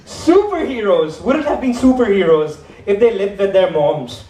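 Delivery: ordinary talk, nothing marked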